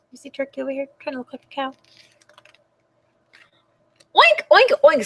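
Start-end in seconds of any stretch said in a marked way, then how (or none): 4.2-5.1 high-pitched pig oinks; snorting pig. Acted. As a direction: squeal out oinks for the children. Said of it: "snorted Pig".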